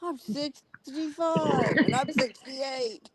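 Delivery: slow mock quote. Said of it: "That's far away".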